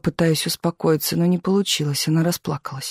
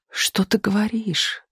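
smiling, soft; 3 semitones higher, 3 dB quieter, slower than usual. A narrator's reading. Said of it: "улыбнулся счастливый Туманов".

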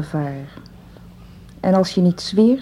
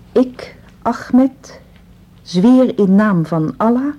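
solemn dictation; 2 semitones higher, 4 dB louder, much slower than usual. Acted.

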